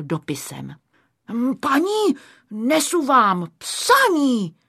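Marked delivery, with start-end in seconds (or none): 1.6-4.5 high opening, drawn-out delivery; clear little voice. A narrator's reading. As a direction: announce it in a bright little voice. Škubánek opakuje své oznámení. (jasný zvučný hlásek)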